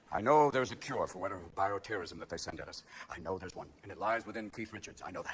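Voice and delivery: low, very soft voice; quiet, low and sure; hushed confiding. Acted. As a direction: drop right down, as if sharing a secret. Mock-confidential claim about [name].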